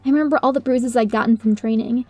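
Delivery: ordinary delivery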